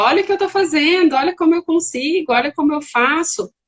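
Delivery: eager child showing off, lightly acted; child seeking attention. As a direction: call for a parent to watch. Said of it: "tem criança que fala".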